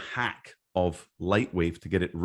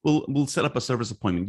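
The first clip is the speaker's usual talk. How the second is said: higher, breezy go-along voice; lightly voiced cheerful company rep; obliging employer; 7 semitones higher than usual. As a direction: toss it off like an easygoing manager. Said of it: "And like".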